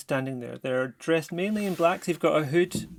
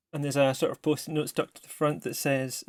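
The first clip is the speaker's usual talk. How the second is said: ordinary talk, nothing marked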